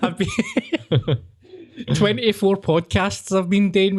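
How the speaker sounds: high-pitched laughing protest. Real, not acted. Laughing at his co-host's teasing. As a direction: talk through the giggles.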